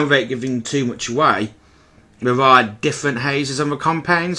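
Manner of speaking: ordinary talk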